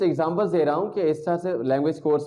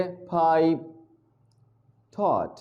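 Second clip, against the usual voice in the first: slow pronunciation demo; much slower than usual. Shown as a demonstration. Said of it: "एंड पे आती है ... aspiration ख़त्म".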